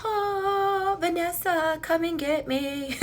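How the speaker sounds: high, soft, eerie siren call; wig calling out. Pretend. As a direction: beckon softly in a high, drawn-out voice. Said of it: "it was calling me. It was like".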